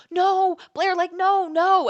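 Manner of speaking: loud, high mock wail; pleading protest. Friends hearing she is leaving, pleading protest. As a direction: imitate friends crying out in protest. Friends begging her not to leave.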